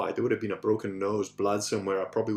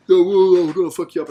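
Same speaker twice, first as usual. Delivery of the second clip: much louder and higher, threatening shout; aggressive yell; 7 semitones higher, 14 dB louder than usual. Retold or imitated. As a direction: bark it like a drunk picking a fight. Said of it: "started, like".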